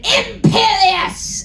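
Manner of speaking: high, slow mock spell shout. Voldemort casting a spell on the hero, slow high shout. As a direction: cast it with a drawn-out villain cry. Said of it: "Voldemort shouted".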